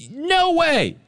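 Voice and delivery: a lightly replayed airport yell; retold yell. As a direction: call out firmly to make them help. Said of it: "I had to raise my voice and yell a little bit".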